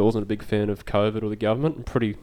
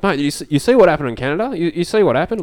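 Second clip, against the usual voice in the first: imitating a fired-up truckie, pitched higher and louder; worked-up tow truck driver; 8 semitones higher, 8 dB louder, faster than usual. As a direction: ask it like a bloke itching to rant.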